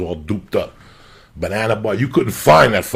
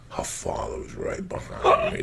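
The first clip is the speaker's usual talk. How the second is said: whisper; 8 dB quieter than usual. Retold or imitated. Whispering about father behind him.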